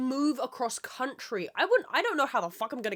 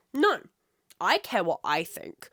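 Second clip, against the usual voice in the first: usual speaking voice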